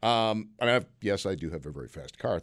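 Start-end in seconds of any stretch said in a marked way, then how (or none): 1.3-2.4 much lower, softer quick aside; mumbled aside. Real, not acted. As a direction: toss it off under your breath.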